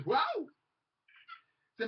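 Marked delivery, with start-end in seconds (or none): 0.1-0.5 retold wow. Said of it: "I say".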